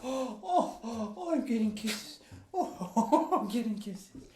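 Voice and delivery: high, giggly squeals; delighted laughing. Real, not acted. Owner giggling high as his dog licks him. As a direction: laugh as the dog kisses you.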